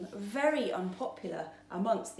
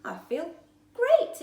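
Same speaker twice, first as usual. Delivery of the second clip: much higher and louder; delighted; 12 semitones higher, 9 dB louder than usual. A narrator's reading. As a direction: burst with joy. Feeling great.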